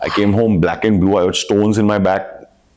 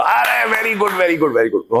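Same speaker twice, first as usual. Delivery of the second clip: delighted praise; 10 semitones higher than usual. Retold or imitated. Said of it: "उनको पता ही नहीं चला".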